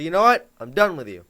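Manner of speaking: sharp, loud snap in a fight; mock yelling during a teen argument. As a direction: fling it at them like you're done. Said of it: "You, you yell at each other".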